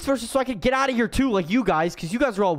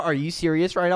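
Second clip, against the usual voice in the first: normal talking voice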